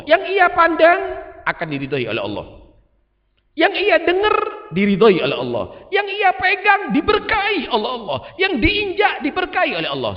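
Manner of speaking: soaring high, shouted praise of the saints; preaching at full force. Acted. Preacher to the congregation, shouting each blessing.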